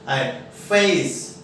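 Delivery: फेस stretched slowly with an आए glide; slow glided vowel. Shown as a demonstration. Teacher to students, modelling फेस slowly. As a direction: slide through आए in फेस. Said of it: "हल्का सा ई का sound भी आएगा".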